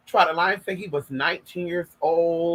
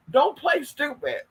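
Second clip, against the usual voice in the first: retold accusation; 9 semitones higher, slower than usual. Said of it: "And he's like".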